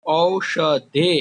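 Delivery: औषधे drawn out slowly, rising at the end; teacher dictating the dual form; slow clear recital. Shown as a demonstration. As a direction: stretch औषधे for the class. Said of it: "तो यहाँ पर होगा".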